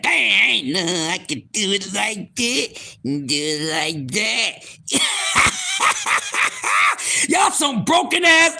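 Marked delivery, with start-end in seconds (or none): 0.0-4.5 mock old man, deeper and slower; slow and low, groaning about getting old; low aging-man voice. Self at thirty, complaining in a slow deep voice. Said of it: "I said". 4.9-7.2 bursts out in a high laugh; cracking up at his own joke. Real, not acted. Laughing at his own old-man bit, high cackle.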